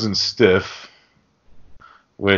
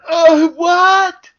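much higher and louder, shrill panic; freaking out Gump; 5 dB louder than usual. Retold or imitated. Mocking Gump's reaction to Lily touching the unicorn, squeaky and much higher. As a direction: flip out in a tiny squeaky voice. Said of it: "The fucking voice fucking kills me".

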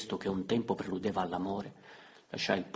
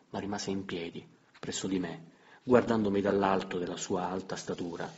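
usual speaking voice

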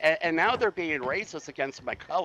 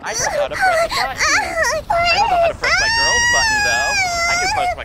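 mock whine; 11 dB louder than usual. Mocking whining gamer voice.

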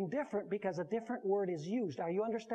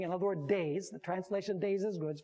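normal talking voice

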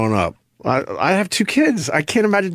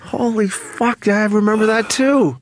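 surprised; 6 semitones higher, slower than usual. Real, not acted. Sudden memory recognition.

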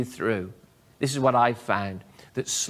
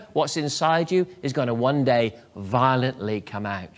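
ordinary talk, nothing marked

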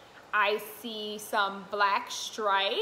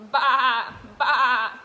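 loud mock goat bleat, ba ba; 9 dB louder than usual. Shown as a demonstration. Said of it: "what sound the goats make".

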